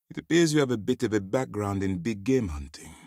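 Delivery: ordinary talk, nothing marked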